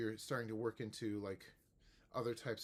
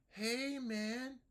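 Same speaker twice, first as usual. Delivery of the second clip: higher hey man, rising; teacher model; 12 semitones higher than usual. Shown as a demonstration. Teacher repeats greeting higher. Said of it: "I'll be a small student".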